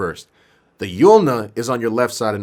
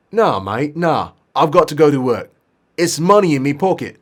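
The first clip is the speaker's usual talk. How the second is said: raised British lad refusing; British accent; 4 semitones higher, 4 dB louder than usual. Acted. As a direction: protest in a put-on British accent. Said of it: "maybe he is British".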